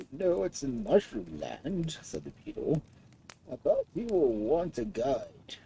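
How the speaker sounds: put-on an old creature taking its time; old beetle voice. A narrator's reading.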